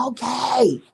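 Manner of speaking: high, strained voice of a flustered father; caving dad. Retold or imitated. Father giving in to his crying daughter, high and exasperated. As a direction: give in, exasperated and pleading.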